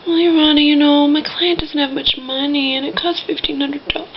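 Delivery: high, mock whiny courtroom plea. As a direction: whine it like a lawyer making excuses. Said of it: "on a whiny type".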